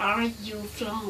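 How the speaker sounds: usual speaking voice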